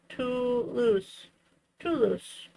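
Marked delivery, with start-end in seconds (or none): none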